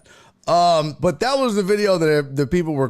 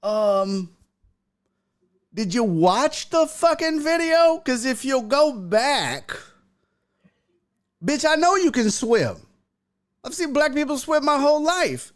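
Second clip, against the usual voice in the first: retold sassy reply; 10 semitones higher than usual. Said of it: "And I was like".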